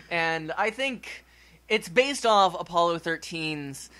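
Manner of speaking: usual speaking voice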